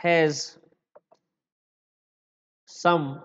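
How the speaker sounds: low, one word at a time; long pauses between words; measured teacher voice for students to copy; slow dictation. Shown as a demonstration.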